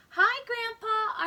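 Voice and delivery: put-on a kid thrilled grandpa has come; excited greeting. Arthur greets arriving grandpa.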